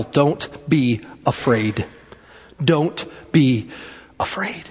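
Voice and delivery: low and slow, word by word; measured, hushed emphasis; slow, deliberate. Real, not acted. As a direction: pause between the words and let each land. Slow repeat of the key statement.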